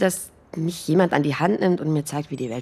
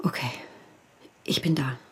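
reassuring whisper; 4 semitones lower, 10 dB quieter than usual. Acted. Soothing friend in hush.

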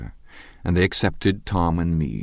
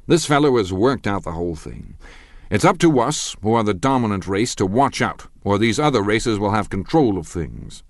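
loud, higher lecturing bully; blustering Tom; 4 semitones higher, 4 dB louder than usual. Acted. Tom lecturing the dinner guests, loud and pompous. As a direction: hammer his half-read theory home.

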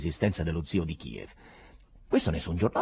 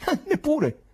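startled cry; 5 dB louder, slower than usual. Acted. Professor's startled exclamation.

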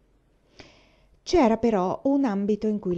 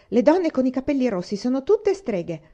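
loud, higher, furious jab; 6 semitones higher, 5 dB louder than usual. A narrator's reading. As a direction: hurl it at her in anger. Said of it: "le aveva detto furiosa". (detto furiosa, più alto e più forte)

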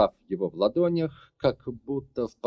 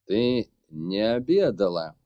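grumbling man; 4 dB louder, slower than usual. A narrator's reading. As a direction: grumble it, annoyed. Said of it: "недовольно буркнул мужчина".

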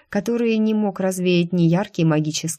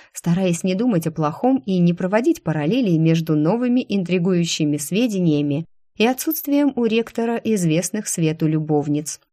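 ordinary delivery, nothing marked

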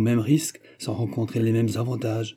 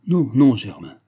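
louder imploring refusal; pleading voice; 6 dB louder than usual. A narrator's reading. Timid brother refuses to marry, pleading loudly. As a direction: beg him not to insist. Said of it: "d'une voix suppliante".

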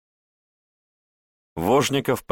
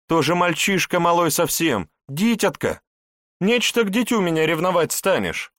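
high, scornful protest; indignant; 11 semitones higher, 3 dB louder than usual. Acted. Wife scoffs at her husband's jealousy, indignant. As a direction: brush off his jealousy loudly.

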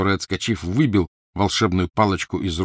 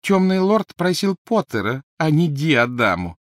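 defiant Crabbe; 9 semitones higher, 5 dB louder than usual. Acted. Crabbe argues with Malfoy.

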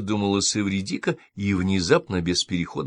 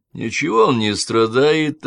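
slow, louder flat-out denial, raised pitch; cutting dismissal; 5 semitones higher, 6 dB louder, slower than usual. A narrator's reading. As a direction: shut the claim down firmly. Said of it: "отрезал абонент".

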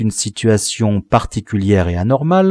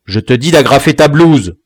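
loud, emphatic command; cross father losing patience; insistent angry order; 3 semitones higher, 7 dB louder than usual. A narrator's reading. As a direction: order a disobedient son, voice raised. Father repeats ignored order.

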